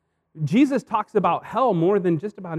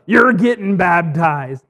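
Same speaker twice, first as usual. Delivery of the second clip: loud, slow preacher's decree; preacher's verdict; 7 dB louder, slower than usual. Retold or imitated. Preacher picking out George in church, loud and slow. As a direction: pronounce it slowly like a verdict. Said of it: "And so he said".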